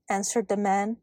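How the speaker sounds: ordinary delivery, nothing marked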